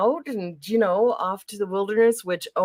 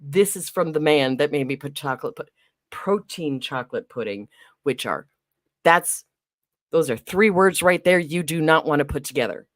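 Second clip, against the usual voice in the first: ordinary talk, nothing marked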